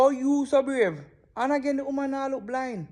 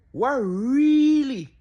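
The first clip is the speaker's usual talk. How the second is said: loud, slow, stretched "really" in disbelief; drawn-out disbelief; 7 dB louder, much slower than usual. Real, not acted. Stunned reaction to the clip.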